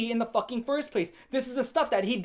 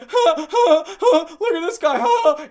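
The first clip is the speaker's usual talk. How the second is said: loud, high fake guffaws; mock laughter; 10 semitones higher, 11 dB louder, slower than usual. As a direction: jeer through exaggerated, put-on laughter. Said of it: "and laugh at him".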